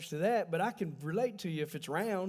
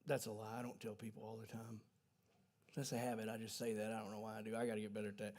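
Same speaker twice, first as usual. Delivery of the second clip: much softer, lower muttered aside; softer, lower admission of a habit; sheepish aside; 7 semitones lower, 14 dB quieter, faster than usual. Real, not acted. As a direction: confess it under your breath. Self-correcting aside.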